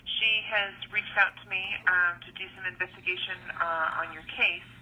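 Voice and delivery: recorded phone message; leaving a voicemail. Real, not acted. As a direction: voicemail over a phone line. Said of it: "here's a phone call I received".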